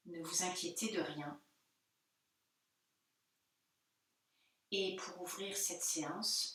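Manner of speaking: soft, unhurried meditation voice with pauses; guided meditation. Real, not acted. Yoga teacher turning listeners inward before the Om, softly guiding. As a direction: settle them gently inward, phrase by phrase.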